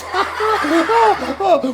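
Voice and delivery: high cackle at his own joke; goofy host giggle; hammed-up laugh. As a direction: laugh big at the silly pun.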